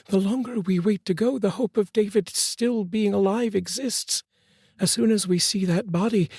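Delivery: pleading mother. A narrator's reading. Mother begging her husband for a few minutes, pleading.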